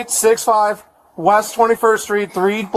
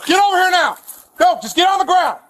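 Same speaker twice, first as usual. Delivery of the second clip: raised, high called orders; calling from far away; 7 semitones higher than usual. Real, not acted. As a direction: call it across the yard. Officer calling across yard.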